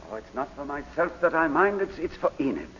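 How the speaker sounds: softly worried confession; troubled, subdued. Acted. Troubled client worrying for wife.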